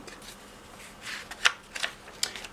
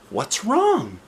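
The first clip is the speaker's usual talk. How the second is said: anxious; 9 semitones higher than usual. Acted. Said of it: "he asked anxiously".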